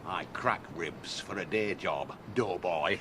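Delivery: quiet, low tough-guy threat; low menace. Acted. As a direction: drop it low and soft to intimidate. Mock threat before sparring bout.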